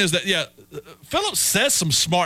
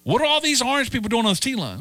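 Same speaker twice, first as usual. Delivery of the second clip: lightly voiced puzzled local, higher and fast; 9 semitones higher, faster than usual. Host mimics a confused T rider, mildly higher and fast. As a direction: play a baffled Bostonian asking about the orange crowd, higher and quick.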